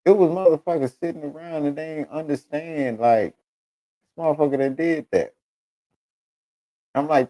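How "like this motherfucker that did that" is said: normal talking voice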